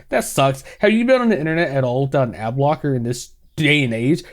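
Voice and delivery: ordinary talk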